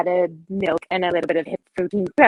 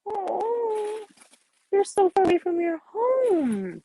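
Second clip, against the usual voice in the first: tender; 5 semitones higher than usual. Acted. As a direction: comfort the frightened children gently. Caring character comforts traumatized kids.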